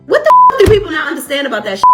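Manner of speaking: high, loud exasperated outburst; outraged. Real, not acted. Ranting at the mother over her parenting, outraged burst. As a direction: explode in disbelief.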